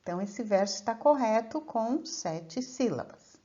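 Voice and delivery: ordinary talk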